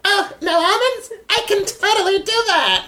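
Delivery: high, bubbly airhead voice; ditzy giggly airhead. Retold or imitated. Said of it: "to play stupid with people".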